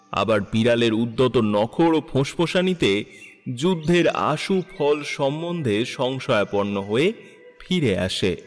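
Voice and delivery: normal talking voice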